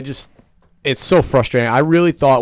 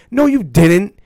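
indignant; 8 semitones higher, 5 dB louder, slower than usual. Real, not acted. Ranting at Goldberg's speech.

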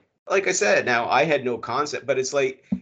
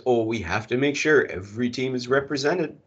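ordinary talk, nothing marked

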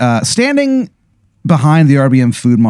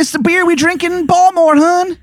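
put-on much higher Baltimore accent; bad Maryland accent. Informant explains local beer to the player, Maryland accent. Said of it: "the worst Maryland accent".

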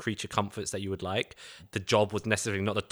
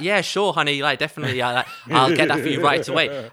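chirpy waitress; 5 semitones higher, 7 dB louder than usual. Retold or imitated. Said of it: "she's like".

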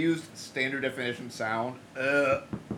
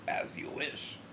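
hushed, much lower sinister whisper; whispered genie; 6 semitones lower, 11 dB quieter, slower than usual. Retold or imitated. Teasing friend about his wish as a whispering genie. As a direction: breathe "As you wish" as a creepy genie. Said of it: "he'd be like".

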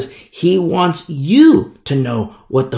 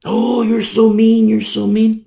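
higher mocking whine; 7 semitones higher than usual. Preacher mocking the congregation's complaint in a higher whine. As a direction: whine it like an offended listener. Mocking listeners who call him mean.

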